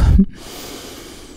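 a breathy giggle, barely voiced; whispered giggle. Real, not acted. Host giggles at own slip, whispered giggle. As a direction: giggle under your breath, embarrassed.

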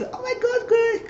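very high relieved squeal; delighted Blanca. Retold or imitated. Blanca hearing good news, high joyful squeal. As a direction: gush it out in relief. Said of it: "And then Blanca was".

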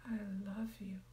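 soft, tender whisper to yourself; loving whisper. Shown as a demonstration. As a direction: whisper it like you mean it. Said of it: "If your name is Sharon".

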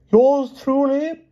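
very high, slow, thin voice of a failing knight; feeble Palomides. A narrator's reading. Palomides in the beast costume, feebly high and slow. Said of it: "said Palomides feebly".

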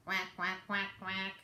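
soft low quacks, a touch of a duck; mother duck quacking. Acted. Mother duck calling her ducklings, soft low quacks. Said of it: "Mother duck said".

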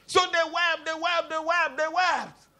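high, sustained repetitive preacher's cadence; dramatized weeping story. Retold or imitated. Preacher retells David's men weeping, high insistent repetition.

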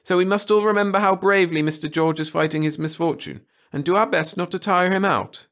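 high, loud teacher voice; schoolteacher to class. Acted. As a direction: call the class together on a trip. Teacher moralising.

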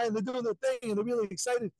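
usual speaking voice

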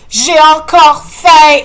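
slow, high, loud complaint; whining loudly. Acted. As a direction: drag it out like a starving kid. Enfant se plaint. (plainte lente et forte)

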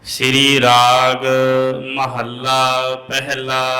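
slow, measured Gurbani chant; scripture recitation. Acted. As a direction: draw out the line endings, pause between phrases.

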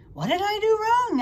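high, loud puzzled cry; baffled question. A narrator's reading. Pig asks the spider why his jump failed, baffled.

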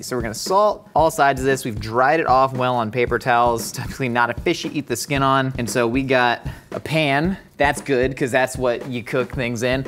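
ordinary talk

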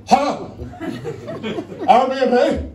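a bewildered guest on the phone; confused caller. Acted.

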